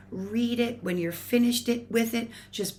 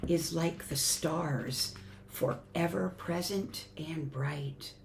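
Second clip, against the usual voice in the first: hushed reading; 4 semitones lower, 7 dB quieter, slower than usual. Acted. Hushed passage about stars.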